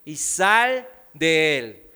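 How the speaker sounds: orden grave y lenta, un poco más fuerte; reprensión. A narrator's reading. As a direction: reprende con autoridad, sin gritar. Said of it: "le reprendió diciendo". (Jesús reprende al demonio, lento y firme)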